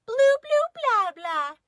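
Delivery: put-on high, loud baby babbling; baby sister copying the others in babble. A narrator's reading. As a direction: babble like a baby trying to talk. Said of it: "because she's a baby".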